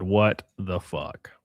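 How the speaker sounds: drawn out very slowly and low; slow, stunned. Shown as a demonstration. Streamer re-reading her reply, slow and stunned. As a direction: let each word hang in disbelief.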